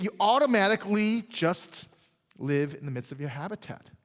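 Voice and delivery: ordinary talk, nothing marked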